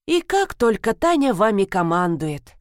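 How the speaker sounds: ordinary delivery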